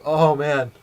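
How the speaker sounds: loud amazed gasp of a line; stunned. Retold or imitated. Narrator reacting to the smashed cases, loud amazement. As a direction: blurt it out, shocked.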